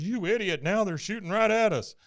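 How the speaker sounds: lightly hammed-up scolding under fire; retold angry yell. Hank berates a friend as shells come in, mock exasperated. Acted angry quote in recap.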